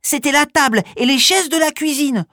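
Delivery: put-on high curious child voice. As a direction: ask it like a curious kid. Child questions the mouse.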